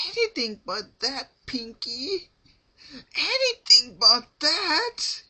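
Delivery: very high, loud desperate begging; pleading. A narrator's reading. Rarity begging Pinkie not to pull her tail, high frantic plea. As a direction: beg for mercy in a strained high voice.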